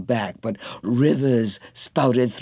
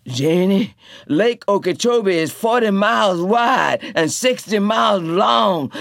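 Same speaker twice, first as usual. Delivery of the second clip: Tea Cake urging; 7 semitones higher, 4 dB louder than usual. A narrator's reading.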